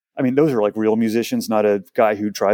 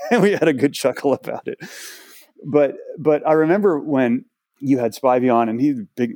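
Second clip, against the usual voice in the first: ordinary delivery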